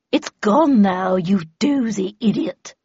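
slow, drawn-out grumble; slow, scornful aside; exasperated, to herself. Acted. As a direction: grumble the insult to yourself. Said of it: "said Havisham to herself".

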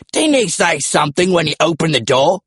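British dinosaur, pitched high; British accent. A narrator's reading. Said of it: "British accents were unexpected".

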